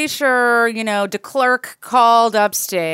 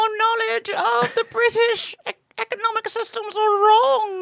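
mock critic; 9 semitones higher than usual. Mocking a tweeting critic.

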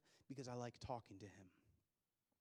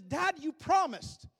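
very slow, higher, louder pleading of a crying son; tearful child's plea; 21 dB louder, much slower than usual. Retold or imitated. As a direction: stretch it out like a tearful kid. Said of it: "in all the tears running down his face".

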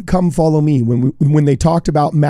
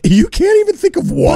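trolling; 11 semitones higher than usual. Retold or imitated. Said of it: "He did troll him a little bit".

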